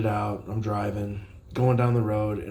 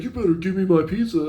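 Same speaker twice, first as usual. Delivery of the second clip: higher, louder, fast mock threat; mock demand; 9 semitones higher, 6 dB louder, faster than usual. Imitating the customer demanding his pizza, mock threat. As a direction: snap it like an angry customer. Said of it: "He's like".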